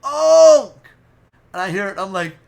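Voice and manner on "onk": very loud, high holler; one big drawn-out shout; yelled call. Retold or imitated. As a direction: bellow it from down the block.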